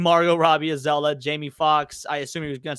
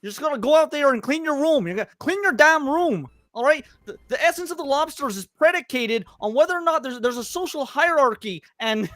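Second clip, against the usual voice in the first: higher lecturing Peterson impression; Jordan Peterson impression; 9 semitones higher than usual. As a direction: lecture it like a stern professor. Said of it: "a decent Jordan Peterson".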